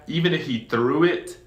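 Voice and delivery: ordinary talk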